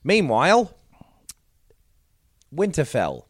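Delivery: normal talking voice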